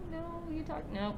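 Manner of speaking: ordinary delivery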